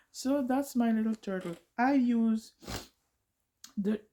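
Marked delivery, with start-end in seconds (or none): 1.8-4.0 frog in throat. Real, not acted. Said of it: "I think I got a frog in my throat".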